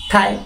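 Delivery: "থাই" in a short falling fourth tone. Shown as a demonstration. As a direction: drop the pitch through "থাই". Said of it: "এটা হচ্ছে fourth tone". ("থাই" চতুর্থ টোনে, সুর নামিয়ে)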